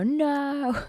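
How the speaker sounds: high, drawn-out mock "no", breaking into laughter; playful protest. Real, not acted. Streamer jokes about infinity divided by zero, playful protest. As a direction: cry out in mock refusal.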